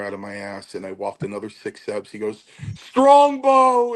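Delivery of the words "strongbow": high, loud bellowed call of a name; bellowing shout. Retold or imitated. Tony shouting at the wrestler, a high bellow. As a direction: belt the name out across the room.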